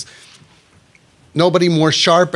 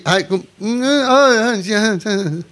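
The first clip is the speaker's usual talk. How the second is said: awkward mock greeting; 6 semitones higher, slower than usual. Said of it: "So maybe".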